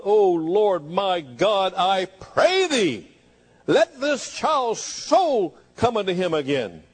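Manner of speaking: drawn-out, rising fervent plea; fervent crying out. Acted. Elijah praying over the dead child, crying out fervently. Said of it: "cried out loud, fervently from his spirit".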